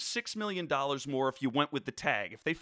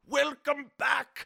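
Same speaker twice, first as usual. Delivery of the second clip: slow, higher put-on British accent; 8 semitones higher, slower than usual. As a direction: draw out the welcome in British accent. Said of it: "That was a little, little British".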